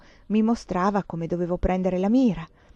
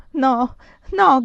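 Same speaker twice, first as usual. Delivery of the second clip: higher, louder cry of refusal; frightened cry; 5 semitones higher, 6 dB louder than usual. A narrator's reading. Countess aims with eyes shut, frightened cry. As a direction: cry out in fear, pitched up. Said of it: "gridavo con gli occhi chiusi".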